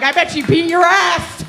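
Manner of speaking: high, loud gleeful taunt; taunting shout. Retold or imitated. Comic taunts the last guy, loud gleeful jeer. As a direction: yell it to rub it in.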